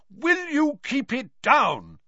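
high, slow, drawn-out yell; angry, stretched-out shout; bellow. A narrator's reading. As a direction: bellow in annoyance at the noisy ones below. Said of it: "he bellowed".

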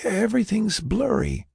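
very high, frail voice; weak patient. Acted. Patient describes failing sight, high weak voice.

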